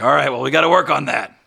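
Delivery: loud, dry coaching verdict; loud coach remark. Acted. Coach loudly telling the thrower to improve. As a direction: tell him off like a coach. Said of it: "He's like".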